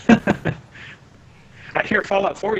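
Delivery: laughing through the words, slowly. Real, not acted. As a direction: chuckle out the words. Laughing while answering.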